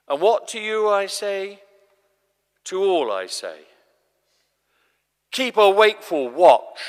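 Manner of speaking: loud, building final charge; urgent command. Acted. Jesus giving his closing charge to all, loud urgent command. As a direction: proclaim the last command to everyone.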